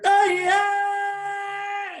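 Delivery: high, loud sung battle cry; long ringing charge yell; war cry. Retold or imitated. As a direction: let out a wild Gaelic war cry. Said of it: "Gaelic war cry".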